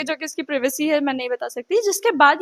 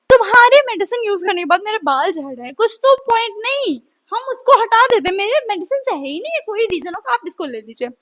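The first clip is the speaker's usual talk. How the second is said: usual speaking voice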